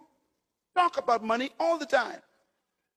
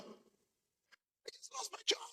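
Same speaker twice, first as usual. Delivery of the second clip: breathy whisper, high and broken, "I just lost my job"; 11 semitones higher, 19 dB quieter, slower than usual. Retold or imitated. Pastor imitating laid-off church lady, a tearful whisper. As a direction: barely voice it, like holding back tears. Crying woman retold losing job.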